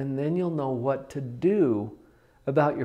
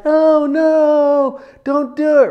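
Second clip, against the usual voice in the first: high, loud alarmed cry; panicked parent; 11 semitones higher, 10 dB louder, slower than usual. Pretend. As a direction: cry out as if the kid is about to fall. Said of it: "they're like".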